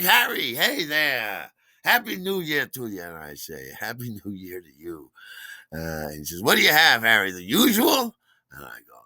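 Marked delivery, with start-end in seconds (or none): 0.0-3.0 loud, high, hearty welcome; super friendly bartender. Retold or imitated. Bartender greeting a regular on New Year's, booming friendliness. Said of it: "our super friendly bartender". 6.4-8.2 loud, high, chummy bartender; Terry. Retold or imitated. Bartender asking a regular his order, loud and chummy. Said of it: "our super friendly bartender".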